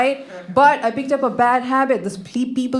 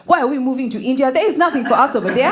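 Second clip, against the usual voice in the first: put-on higher-pitched Kenyan-accented protest; 4 semitones higher, faster than usual. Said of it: "my original accent was a Kenyan accent".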